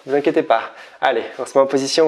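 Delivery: normal talking voice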